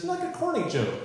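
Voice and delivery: a hint of an exasperated daughter. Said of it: "And she says".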